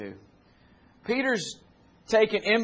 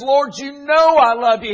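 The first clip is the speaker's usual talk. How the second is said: Peter's answer pushed out higher and louder, hurt and angry; angry reply; 10 semitones higher, 11 dB louder than usual. Acted. Grieved Peter answering Jesus a third time, angry. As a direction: snap back at the Lord, higher and louder. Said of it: "I'm gonna go ahead and say angry".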